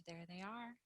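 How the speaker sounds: ordinary delivery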